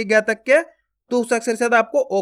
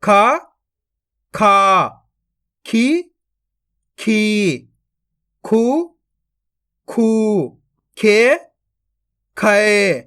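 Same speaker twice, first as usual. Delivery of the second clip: syllable drill, short rising then long level-falling vowels; barahkhadi recitation; 4 dB louder, much slower than usual. Shown as a demonstration. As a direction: recite each syllable apart for pupils to repeat, stretching every second one. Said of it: "मैं किस तरीके से इनको पढ़ रहा हूँ". (बारहखड़ी का पाठ, एक-एक अक्षर खींचकर)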